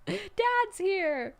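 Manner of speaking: high, loud kiddish call; mock kid delight. Reader joking as the juniors, loud and childish. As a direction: shout like a kid seeing dad arrive.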